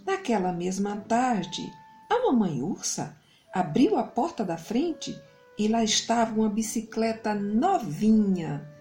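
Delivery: plain reading voice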